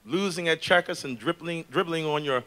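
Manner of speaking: measured, dramatic monologue of a care-home resident; poem recital. Acted. Care-unit resident airing grievances to the audience, dramatic poem recital. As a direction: recite it with wry, weary complaint and deliberate pauses.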